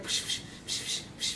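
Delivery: soft rhythmic puffs of air; oxygen machine hiss. Retold or imitated. Granddaughter imitates grandfather's oxygen machine, hissing. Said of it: "I hear the machine, and it's like".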